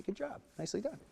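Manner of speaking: soft, high praise for the horse; a pleased, soft pat in words; hushed good-job to a mare; gentle praise. Shown as a demonstration.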